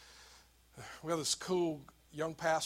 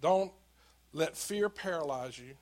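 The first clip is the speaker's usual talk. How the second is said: ordinary delivery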